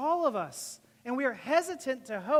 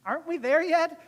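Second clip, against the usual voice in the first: impatient mock kid on a car ride, raised and louder; antsy; 5 semitones higher, 6 dB louder than usual. Restless passenger on a long car ride, antsy. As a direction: pipe up higher, itching to arrive. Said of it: "antsy, like".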